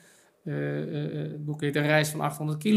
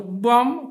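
loud, higher-pitched imitated impact; sudden "bam"; 6 semitones higher, 6 dB louder than usual. Imitates sudden arrival of insight. (plotselinge, luide "bam")

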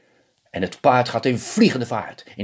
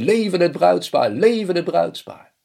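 high, loud cheering for the couple; cheering crowd; 8 semitones higher, 4 dB louder than usual. A narrator's reading. Wedding guests cheer the bride and groom, high and loud. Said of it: "iedereen begint te juichen en te schreeuwen".

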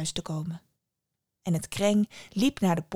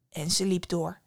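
plain reading voice

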